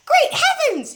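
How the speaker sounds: a father hopping about in shock; excited cry. A narrator's reading. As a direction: yelp it like your feet are burning. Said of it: "he cried".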